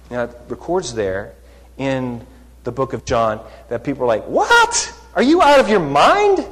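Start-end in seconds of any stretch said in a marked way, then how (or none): 4.3-6.5 much higher, louder incredulous cry; shocked as if he's lost his mind; shocked disbelief. Retold or imitated. The crowd reacts to Jesus in higher, louder shocked disbelief.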